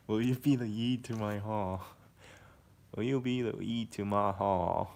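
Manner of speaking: hammed-up country twang; American country accent, put on. Shown as a demonstration. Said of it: "That is a country accent".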